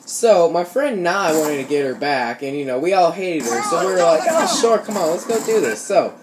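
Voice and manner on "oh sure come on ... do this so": ordinary talk, nothing marked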